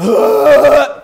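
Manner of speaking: loud, high mock retching gasp; loud retching gasp noise. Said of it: "Then it got louder".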